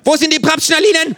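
viel höher und lauter; laut fordernd. A narrator's reading. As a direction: schreiend verhören. Känguru verhört. (Känguru verhört den Erzähler, laut)

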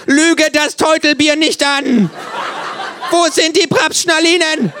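viel höher, lauter, langsam; wütend losbrüllen; Gebrüll. A narrator's reading. Känguru brüllt. (Känguru brüllt den Erzähler an)

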